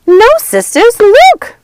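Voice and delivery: very high, loud thrilled cry; delighted witch shrieking; excited witch. A narrator's reading. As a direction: squeal with glee. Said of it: "Ruby said".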